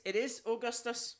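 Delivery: ordinary talk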